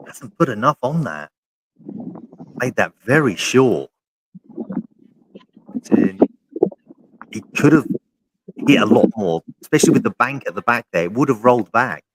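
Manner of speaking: rising excitement over a missed putt; golf commentator. Real, not acted. Excited live putt reaction.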